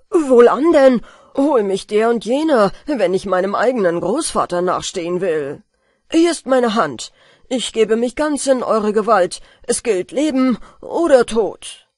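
loud, rousing vow; bold declaration. A narrator's reading. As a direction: declare it like a daring oath. Jack boldly commits himself.

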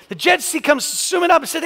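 normal talking voice

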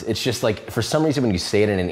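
usual speaking voice

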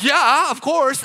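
high, drawn-out eager yes; eager excitement. Retold or imitated. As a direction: act out an excited recruit jumping at it. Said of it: "really excited".

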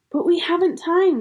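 high-pitched, anxious objection; urgent protest. A narrator's reading. Susan to Missus Beaver packing for the journey, urgent protest.

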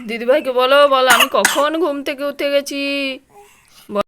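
put-on high, loud sing-song coaxing the baby; loud, lilting baby talk to the infant. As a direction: coo brightly up at the little one. Mother coos at the baby.

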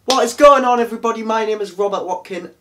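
upbeat loud YouTube greeting; bright hello to viewers; energetic intro. Real, not acted. Real intro after silly take.

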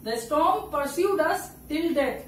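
very slow, words spaced apart; slow example reading. Shown as a demonstration. Teacher slowly repeating the example line for students. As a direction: spell the line out slowly for the class. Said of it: "next example है".

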